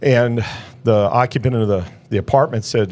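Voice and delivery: ordinary talk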